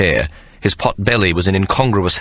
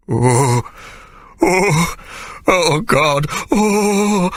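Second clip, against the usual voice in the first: high, drawn-out moans; moaning; 11 semitones higher, much slower than usual. A narrator's reading. Sir Jasper in pleasure, high moaning. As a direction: let each oh rise into a moan. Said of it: "Sir Jasper moaned".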